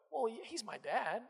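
soft, slow, faltering defence; unsettled reply. Acted. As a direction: answer quietly, thrown by the doubt. Acting his own unsettled reply to doubt.

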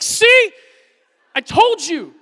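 high loud gloating cry; triumphant I told you. Retold or imitated. Dad gloating to son after the first bite, high and loud. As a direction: crow it in triumph.

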